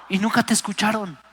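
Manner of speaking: normal talking voice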